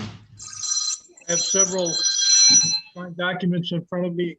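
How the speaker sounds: muffled call audio; muted. Real, not acted. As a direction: speak as if the line is muffled. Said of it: "you sound a little muted".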